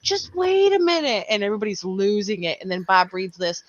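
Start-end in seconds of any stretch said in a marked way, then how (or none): 0.0-1.2 hammed-up Bob voice crying wait; Bob's high protest. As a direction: squeak out a stalling plea like Bob. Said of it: "Here goes Bob".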